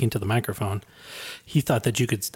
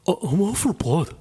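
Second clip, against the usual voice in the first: higher put-on executive voice; lightly voiced tech CEO; Elizabeth Holmes voice; 7 semitones higher than usual. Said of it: "You sound just like her".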